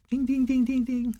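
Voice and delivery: high sing-song ding ding; ghostly keys ringing, sung high; imitated key jingle. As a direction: chime the keys up high and soft.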